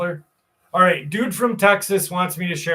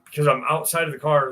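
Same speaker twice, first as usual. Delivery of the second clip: ordinary talk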